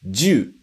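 "ju" as one sound, ju-u; teacher models "ju" in one syllable; contracted juu. Shown as a demonstration. Teacher showing pistol word: "ju" contracted. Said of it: "E a segunda palavra".

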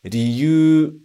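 "Ryu" said ri-yu-u, sounds separate; teacher models "Ryu" with syllables apart; uncontracted riyuu. Shown as a demonstration. Teacher showing reason word: "Ryu" as ri-yu-u. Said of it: "Primeiro, o motivo".

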